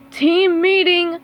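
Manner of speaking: slow, high, loud summons; calling out. Acted. Squidward summoning his team at practice, loud call. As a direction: call the team over, drawn out. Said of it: "called Squidward".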